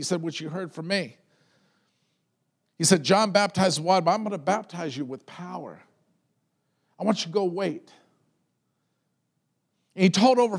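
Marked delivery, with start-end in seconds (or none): none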